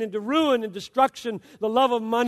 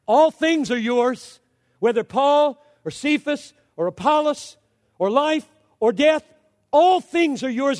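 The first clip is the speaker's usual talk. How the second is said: full-force preaching; 3 semitones higher, 4 dB louder than usual. Real, not acted.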